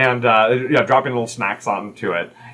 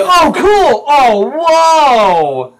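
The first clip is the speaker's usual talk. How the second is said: high, loud drawn-out cry of wonder; amazed kids; 9 dB louder, much slower than usual. Acted. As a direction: shout like a thrilled child from another room. Kids exclaim off-scene.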